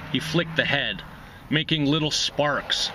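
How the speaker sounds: ordinary delivery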